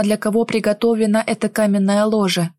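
usual speaking voice

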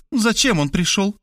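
high, pointed question; fired-off question. A narrator's reading. Maria demands why Victor came, sharply questioning. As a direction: fire the question at them. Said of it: "выстрелила вопросом Мария".

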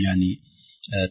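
ordinary talk, nothing marked

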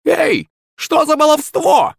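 high, slow outraged crone shout; indignant cry. Acted. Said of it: "возмущённо вскрикнула старушка".

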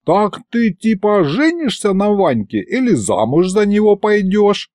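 high-pitched heckle, little added volume; mild mock yell; shouted question. A narrator's reading. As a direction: call out the jibe from the crowd. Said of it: "выкрикнул Аристофан".

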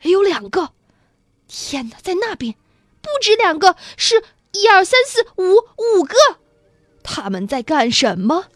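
high, startled exclamations; excited cries. A narrator's reading. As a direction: burst out as several amazed onlookers. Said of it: "现在所有人同时叫起来".

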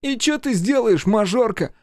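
high sneering taunt; mocking. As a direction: taunt her with scorn. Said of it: "насмешливым тоном спросил он".

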